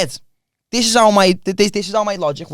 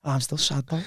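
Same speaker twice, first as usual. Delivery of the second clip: small, low, much softer voice; hushed, sheepish admission of sadness; mock sad aside; 8 semitones lower, 13 dB quieter than usual. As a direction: drop right down into deflated quiet. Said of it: "Every time I get sad".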